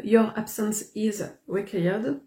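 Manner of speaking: slow, careful English with a strong French accent; self-mocked English accent. Real, not acted. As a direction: read the English line slowly in a heavy French accent. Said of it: "mon accent anglais est pitoyable".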